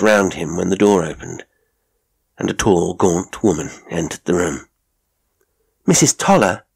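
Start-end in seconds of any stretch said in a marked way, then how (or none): 5.9-6.6 high exclamation of surprise; startled cry. A narrator's reading. Miss Hunter seeing Mrs Toller enter, startled cry. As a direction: call the name out high. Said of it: "cried Miss Hunter".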